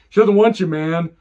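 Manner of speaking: she doesn't want you, shouted high; loud taunting outburst; mock yell. Telling Reggie to stay away, loud mock yell.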